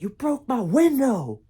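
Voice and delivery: high, loud accusing yell; angry yell. Retold or imitated. Rich kid yelling at the robber, high and loud. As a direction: hurl it at him like a furious owner. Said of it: "he went".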